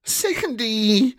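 calling out. Acted. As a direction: call to her in alarm. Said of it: "called Rosa".

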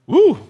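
high loud woo at the sourness; loud sour-taste whoop. Real, not acted. Reacting to a very sour beer.

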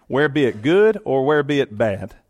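ordinary talk, nothing marked

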